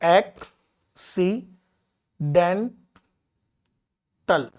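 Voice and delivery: एक्सीडेंटल split into four slow syllables, ac-ci-den-tal; एक्सीडेंटल, spaced out for counting syllables. Shown as a demonstration. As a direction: say एक्सीडेंटल very slowly, pausing after each syllable. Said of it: "और syllable बढ़ गए".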